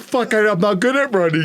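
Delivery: high, breathless panicked complaint; mock panic mid-chase; panicked whine. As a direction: gasp it out while running. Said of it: "And I'm like".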